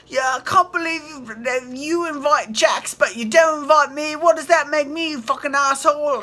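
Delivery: high, loud, whining put-on accent that builds to a shout; mocking James Kennedy. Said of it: "That accent is killing me".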